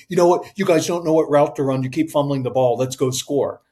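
mock scolding quarterback; panicky berating. Quarterback blaming his team in the huddle, berating. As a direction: chew out the team, starting punchy. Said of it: "calm and cool under pressure".

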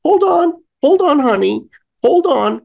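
high-pitched checkout helper; high mock store-clerk voice; imitated store lady. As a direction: put on a high voice for the lady assistant. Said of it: "the lady comes over and she's like".

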